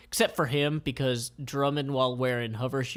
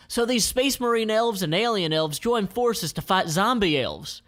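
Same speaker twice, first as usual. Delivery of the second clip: high, loud excited pitch; pitching gentleman; 8 semitones higher, 5 dB louder than usual. Acted. Gentleman pitching film to CEO, excited. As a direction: sell the movie idea hard.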